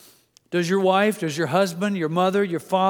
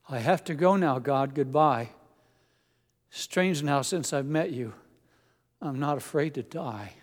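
poem recitation. Acted. Preacher reading a Vietnam soldier's poem to the congregation, earnest recitation.

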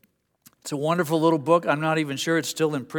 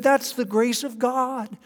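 John Piper voice; 8 semitones higher than usual. Retold or imitated. Piper remembering his friendship with MacArthur, high and eager. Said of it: "he's got a high-pitched voice".

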